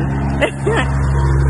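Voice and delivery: loud, high delighted laugh. Real, not acted. Fan gushing about how sweet he is, delighted laugh. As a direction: burst out laughing, loud and happy. Laughs after saying she loves him.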